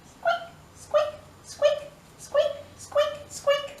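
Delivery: very high, tiny squeaks; mouse squeaking. Acted. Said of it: "there's a little mouse".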